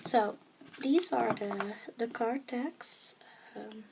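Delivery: usual speaking voice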